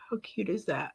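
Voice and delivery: breathy little whisper. Real, not acted. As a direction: whisper it like a secret. Aside on tiny mascara.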